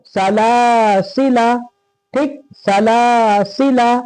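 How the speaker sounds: "सलासिला" with long middle laa, quick final la; short final alif. Shown as a demonstration. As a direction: stretch the middle of "सलासिला", clip only its last vowel. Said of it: "इसको जल्दी पढ़े".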